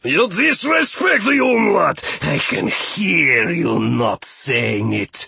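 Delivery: high, loud outburst slowing into a seethe; unnatural rage. A narrator's reading. Steward accuses rabbit of disrespect, raging. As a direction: rage with all civility gone. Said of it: "grumble turned to unnatural rage".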